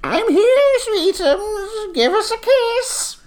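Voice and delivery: high, slow doting grandma; sweet granny voice. Acted. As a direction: coo it like a sweet old granny. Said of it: "A sweet granny voice says".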